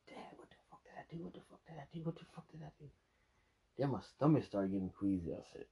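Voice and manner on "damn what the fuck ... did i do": hushed, breathy panic, pitched up; panicked whisper. Retold or imitated. Drunk man whispering to himself as the room spins, panicked.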